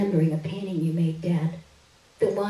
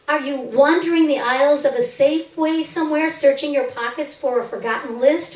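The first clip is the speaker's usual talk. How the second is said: higher and louder, a hurt accusing cry to the missing father; raised pleading reproach, higher and louder; bitter plea to dad; 6 semitones higher, 6 dB louder than usual. Acted. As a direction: call him out, voice rising with hurt. Daughter confronts absent father aloud.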